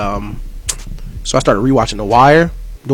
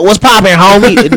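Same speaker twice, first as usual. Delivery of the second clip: brash LA street greeting, higher and louder; mock LA accent; 6 semitones higher, 6 dB louder, slower than usual. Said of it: "not Straight Outta Compton L A accent".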